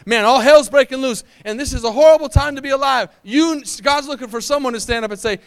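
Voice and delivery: loud, building preacher's cry; full-force preaching. Acted. Pastor rallying the church about the pandemic, loud preaching.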